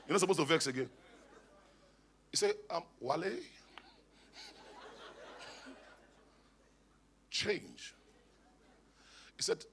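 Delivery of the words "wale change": imitated American accent. Wife correcting her husband seriously, imitated American accent. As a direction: lightly put on an American accent, very quietly. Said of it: "she speaks American".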